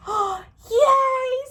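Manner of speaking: high, loud happy squeal; joyful cheer. Acted. Pig cheering as he gets his tail back, loud and high. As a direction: squeal with delight.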